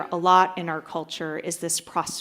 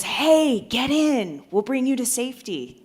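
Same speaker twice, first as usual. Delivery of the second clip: higher, quickening rescuer's call; 6 semitones higher than usual. Acted. Coast Guard to stranded man, calling out. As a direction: hail them and offer rescue.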